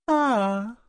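high, teasing mischievous exclamation. Acted. Ophelia teasing Grag in front of Althea, mischievous ah. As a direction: jump in with a sly teasing ah. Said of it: "intervint Ophélie malicieuse".

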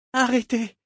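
high female character voice, restrained; low-voiced plea from Malta. A narrator's reading. Malta stopping the captain at swordpoint, contained plea. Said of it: "dit-elle à voix basse".

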